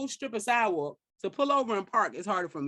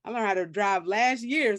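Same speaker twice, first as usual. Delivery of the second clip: usual speaking voice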